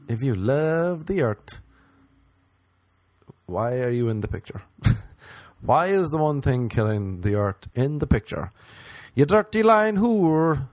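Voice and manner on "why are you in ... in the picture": normal talking voice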